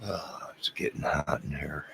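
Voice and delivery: breathy whispered aside; hushed murmur off to the side. Real, not acted. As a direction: say it under your breath, to yourself. Muttered aside on stream.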